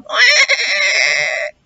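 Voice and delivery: one sad crying quack. A narrator's reading. Duck with a hurt knee crying, high sobbing quack. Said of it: "she was crying".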